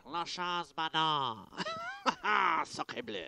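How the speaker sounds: put-on French accent. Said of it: "as the French would say".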